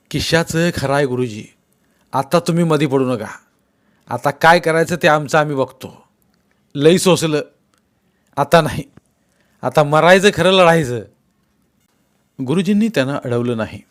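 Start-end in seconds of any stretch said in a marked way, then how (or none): 0.1-11.0 louder, higher call to fight; defiant anger. Acted. Villager telling the teacher to stay out, defiant raised voice. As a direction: rouse them, no more suffering.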